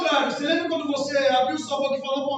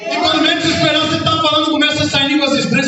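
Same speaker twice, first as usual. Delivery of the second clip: loud, higher, shouted sermon; preaching at full force; 5 semitones higher, 7 dB louder than usual. Real, not acted. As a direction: build it to a fervent shout. Preaching climax to congregation.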